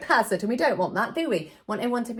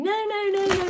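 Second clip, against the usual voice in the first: put-on higher childish no-no-no; kids correcting; 12 semitones higher than usual. Entertainer imitating the children correcting her, lightly higher and eager.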